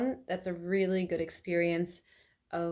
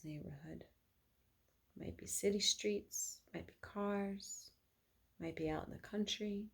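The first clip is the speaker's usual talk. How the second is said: guided meditation. Acted. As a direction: lead them calmly through the imagined walk.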